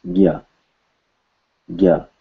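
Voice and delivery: "gya" as one syllable, hard g gliding into ya; "gya" as a clear model syllable; pronunciation shown. Shown as a demonstration. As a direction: say "gya" carefully and separately. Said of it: "It's pronounced gya".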